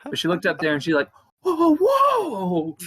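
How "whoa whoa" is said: high loud stunned exclamation; shocked whoa. Retold or imitated. The girl seeing the setup, loud shocked whoa. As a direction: gasp it out in shock.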